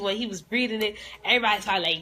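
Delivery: ordinary talk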